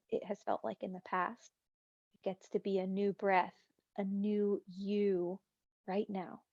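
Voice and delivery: calm meditation voice, long pauses between phrases; guided meditation. Real, not acted. Meditation teacher guides class into breathing, calm and unhurried. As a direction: leave room for breath after each phrase.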